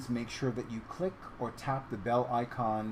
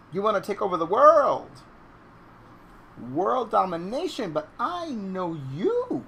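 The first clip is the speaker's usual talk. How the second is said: mock taunting; 8 semitones higher, 9 dB louder than usual. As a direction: needle a pet with theatrical gusto. Teasing cat as would-be conqueror.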